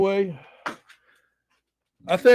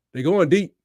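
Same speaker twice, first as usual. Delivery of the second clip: ordinary delivery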